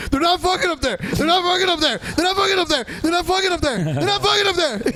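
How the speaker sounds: mock high-pitched meltdown; Scotty. Imitating a friend's meltdown in the chat, high mock screaming. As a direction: imitate a frantic high protest, rapid-fire on repeat. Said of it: "I heard Scotty screaming about how".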